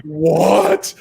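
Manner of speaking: astonished exclamation, replayed for the story; retold shock.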